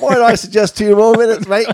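high loud Australian voice; chirpy Australian computer; Australian accent. Retold or imitated. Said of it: "That's if you have the Australian one".

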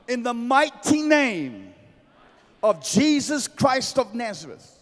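shouted, slow commanding prayer; fiery preacher rebuking money; preaching at full force. Real, not acted.

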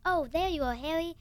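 a hint of a friend calling out in relief; Ron. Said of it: "and then Ron's like".

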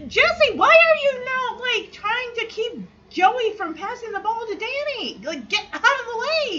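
half-laughing disbelief, then urging him to move aside; exasperated outburst. Real, not acted. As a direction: shout your frustration at a hopeless player. Exasperated at Jesse on court.

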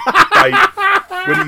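high, loud squealed I; laughing high squeal; Pee-wee squeal. Retold or imitated. Pee-wee squeal mid-story.

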